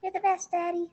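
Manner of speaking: put-on child voice. Child voice for boys.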